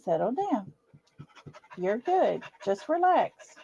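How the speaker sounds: calm the dog down quietly; soothing. Real, not acted. Calming the anxious dog.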